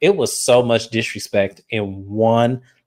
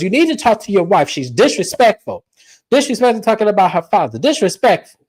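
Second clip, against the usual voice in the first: higher, fast indignant complaint; mock indignant mom; 7 semitones higher than usual. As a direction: rattle off the accusation like an offended mother.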